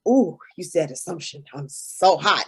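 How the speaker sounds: low-pitched sarcastic swoon; mock swooning. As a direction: feign being turned on, mockingly. Mocks being aroused by big words.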